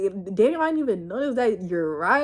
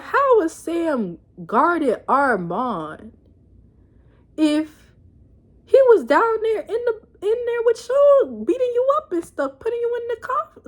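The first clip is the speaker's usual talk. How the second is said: higher, slow incredulous questioning; mock stunned interviewer higher and slow; 9 semitones higher than usual. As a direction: imitate a reporter catching a lie. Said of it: "he was like".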